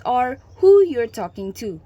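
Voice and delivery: ordinary talk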